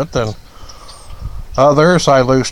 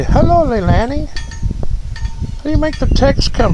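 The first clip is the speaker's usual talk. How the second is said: high, lilting hello with a rise; in-the-head greeting; 11 semitones higher than usual. Shown as a demonstration. Streamer showing Leilani the chat greeting, high lilt. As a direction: sing out the name, pitched up. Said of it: "that's how I say it in my head".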